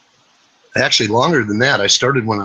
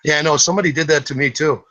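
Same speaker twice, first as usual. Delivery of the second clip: ordinary talk, nothing marked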